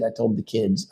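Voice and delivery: usual speaking voice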